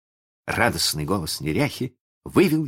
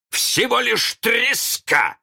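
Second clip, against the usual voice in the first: very high, slow loud taunt; drawn-out jeer, stretched on the last word; taunting captain mimicry; 5 dB louder, much slower than usual. A narrator's reading. Peter mocks Hook, high slow taunt. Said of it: "ответил голос".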